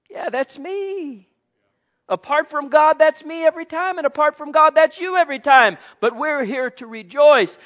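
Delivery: loud, high preaching cry; fervent pulpit call. Real, not acted. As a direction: lift it up like a revival preacher. Preaching at full force.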